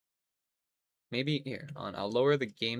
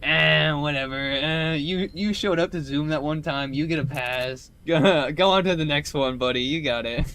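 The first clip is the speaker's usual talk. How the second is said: loud, higher mock dismissive teacher voice; careless teachers; 4 semitones higher, 9 dB louder than usual. Teachers passing anyone in covid online school, mock careless voice. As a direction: wave the student through, loudly. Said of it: "They're like".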